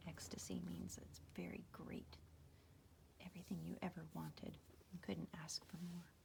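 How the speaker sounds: soft whispered explanation; whispering. Real, not acted. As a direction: whisper the meaning.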